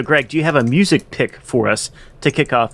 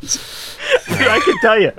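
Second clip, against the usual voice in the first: high breathless laughter while speaking; can barely talk for laughing; laughing hard; slower than usual. Real, not acted. Cracks up over the insulting card.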